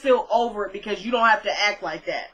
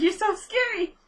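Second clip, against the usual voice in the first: put-on higher-pitched Southern accent, doting; 11 semitones higher than usual. Hugging her thin friend, fussing in a higher Southern accent. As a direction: coo it like a Southern mama in a Southern accent. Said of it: "It was very Southern".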